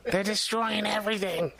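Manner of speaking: high, frantic dubbed-over voice; dubbed narrator voice. Retold or imitated. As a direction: call for Ma in a panic. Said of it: "dubbed over, and he's like".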